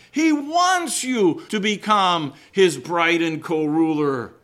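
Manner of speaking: high and loud, slowed, pressing the point home; full-force preaching. Acted. Preacher urging the church about reward, in full force. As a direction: preach it out to the congregation.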